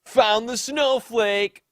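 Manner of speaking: high, slow, loud smug taunt; a stretched-out, gloating jeer. Retold or imitated. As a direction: gloat it at her. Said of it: "Ray responds".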